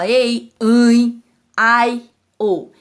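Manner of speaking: "ei", "ãe", "ai", "ou" held one at a time; loud, separate glides, ãe through the nose; isolated vowel pairs. Shown as a demonstration. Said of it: "outros exemplos".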